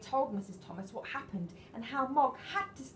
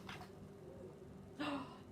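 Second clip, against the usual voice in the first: soft gasp; 5 semitones higher, 11 dB quieter than usual. Acted. Thunder surprise in story.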